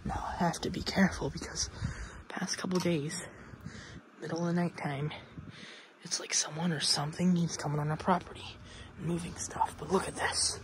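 hushed night whisper. Real, not acted. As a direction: whisper like telling a secret. Spooky story at night outside.

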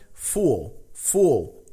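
full with a dark final l; English full. Shown as a demonstration. Teacher drilling full for students. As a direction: say full with rounded vowel and dark l. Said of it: "nada de u".

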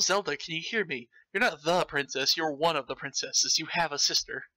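ordinary talk

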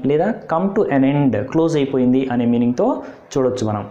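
ordinary talk

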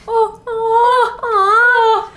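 high, rising mock-tearful "oh"s; swooning oohs. Mock swoon at warmed dead heart.

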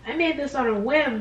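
usual speaking voice

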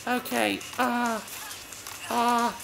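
high-pitched try at a non-English accent; attempted non-English accent. Shown as a demonstration. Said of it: "how to do a not English accent?".

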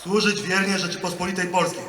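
loud, measured oath line; formal oath dictation. Real, not acted. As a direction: declaim each phrase for the ranks. Leads oath for soldiers to repeat.

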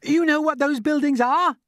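put-on high, loud boy's shout; excited shout. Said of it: "shouted James".

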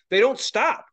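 ordinary delivery

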